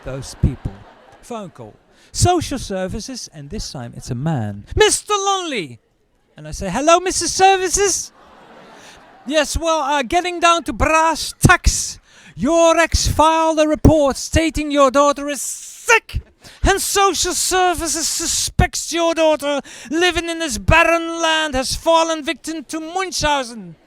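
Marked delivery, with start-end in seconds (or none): 4.8-5.8 high, loud sing-song name; officious greeting. Retold or imitated. Officious caller. 6.7-8.1 high, loud mock-polite; father teasing the social worker; mocking greeting. Mocking the caller. 9.2-23.8 high, loud, stiff bureaucrat; pompous official. Retold or imitated. Pompous social worker voice.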